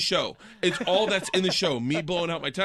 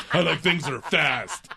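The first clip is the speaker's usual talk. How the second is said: slow, louder mock of daughter's line; mock quote repeated; 5 dB louder than usual. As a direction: repeat it slowly, in disbelief. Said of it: "that's what you wanna hear".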